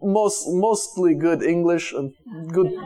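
put-on slow, careful Eastern European accent; accented English, drawn out and deliberate; imitated foreign accent. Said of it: "he had the great accent, talk like this".

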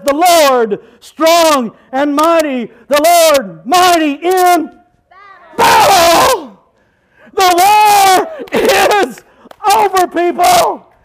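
slow, building to a loud high shout; preaching shout. Acted. Preacher telling the church the war is over, loud shout. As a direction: thunder the victory out.

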